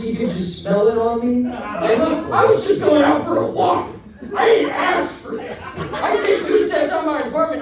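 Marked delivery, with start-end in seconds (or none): 2.0-7.6 high-pitched comic indignation; exasperated protest. Acted. Comedian protesting the stranger's insult to the crowd, exasperated. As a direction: plead your case in a raised pitch.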